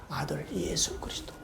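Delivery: hushed, breathy answer; whisper. Real, not acted. Preacher whispering the answer to the congregation. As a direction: whisper the name reverently.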